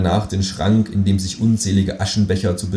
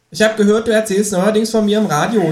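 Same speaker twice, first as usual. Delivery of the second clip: higher, suspicious kangaroo; 13 semitones higher, 4 dB louder than usual. Acted. Kangaroo confronting the narrator about the radio, higher. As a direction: confront him slyly.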